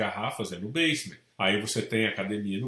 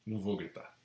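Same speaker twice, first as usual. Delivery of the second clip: softer, murmured repeat of the vow; quiet repeat; 3 semitones lower, 8 dB quieter than usual. Shown as a demonstration. Agent repeating to himself that he won't shout, softly. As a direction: say it again softly to yourself. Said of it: "eu não vou gritar".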